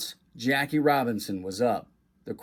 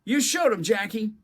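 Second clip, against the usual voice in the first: high, thrilled cheering; excited cheer; 10 semitones higher than usual. Acted. Boy cheering Jackie after the home run, high and thrilled. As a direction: cheer from your seat. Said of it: "stood up in his seat and cheered".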